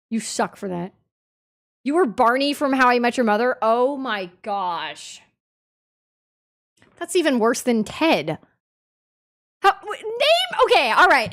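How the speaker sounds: usual speaking voice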